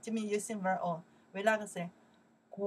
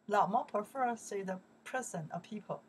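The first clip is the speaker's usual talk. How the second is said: ordinary talk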